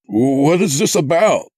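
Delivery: high, loud plea for answers; crying out. A narrator's reading. Narrator confronts the kidnapper, crying out. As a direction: cry out in bewildered distress. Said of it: "I cried".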